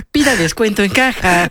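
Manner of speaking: store announcer voice. Retold or imitated. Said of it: "parece que le está hablando la de".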